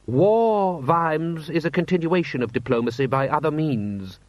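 high, lofty lecturing voice; haughty lord. Acted. Lord Rust lectures the commander on war, haughty. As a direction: launch high on the first word, then settle.

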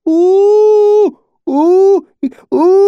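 very high, loud hooting wails; long bellows broken by half-gasps; bellowed cry. A narrator's reading. High bellowing cry with half-gasps. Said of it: "Tanny bellowed, strange half-gasps punctuating his cry".

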